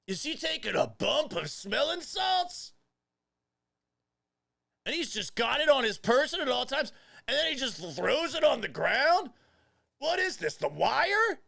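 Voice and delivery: loud, high incredulous shouting; hammed-up disbelief, loud and high; mock outrage. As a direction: shout it like a scandal broke. Outraged over smelling salts footage.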